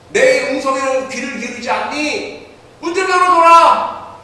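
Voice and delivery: high, loud urgent pleading; pleading Jesus. Acted. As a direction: call through the door, begging to be let in. Jesus knocking, pleading to enter. (높고 크게 간절히 호소하는 예수님의 목소리)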